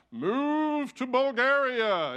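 slow, loud, booming voice of God; mock thunderous divine command; big booming voice. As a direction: boom it out slowly like a voice from heaven. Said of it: "in this big booming voice like".

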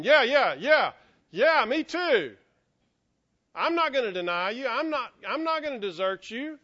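loud, high me-too agreement; eager chorus of others. Retold or imitated. Other disciples echoing Peter's promise, loudly eager. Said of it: "all the others are back there going".

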